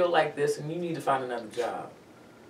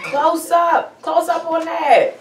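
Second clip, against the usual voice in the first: excited shout; 14 semitones higher, 12 dB louder, slower than usual. Real, not acted. Excited by her magazine photo.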